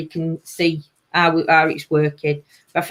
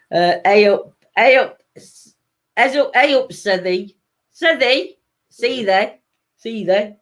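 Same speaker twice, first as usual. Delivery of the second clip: lively Yorkshire greeting, "hey up, Sithy", pitched up; mock Yorkshire accent, upbeat; 3 semitones higher than usual. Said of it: "The northern accent?".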